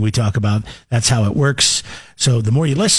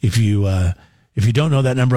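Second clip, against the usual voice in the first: usual speaking voice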